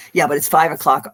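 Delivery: usual speaking voice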